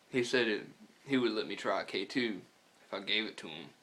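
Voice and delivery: normal talking voice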